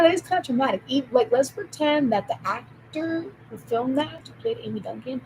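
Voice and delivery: normal talking voice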